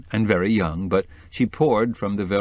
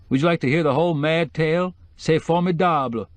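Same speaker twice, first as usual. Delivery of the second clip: much higher, delighted decorator voice; gleeful decorator; 9 semitones higher than usual. Acted. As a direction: offer the story with showy relish. Decorator eagerly offers his tale.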